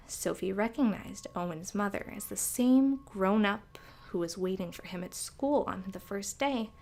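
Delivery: ordinary delivery, nothing marked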